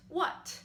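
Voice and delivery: "what" starting high and dropping sharply; high falling "what"; fourth tone. Shown as a demonstration. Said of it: "put on the four syllables for what".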